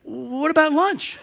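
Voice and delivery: slow, high, plaintive question; earnest bewilderment. Retold or imitated. Hungry guest to departing coworker, acted earnest bewilderment. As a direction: draw it out as a sincere question. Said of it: "And I was serious".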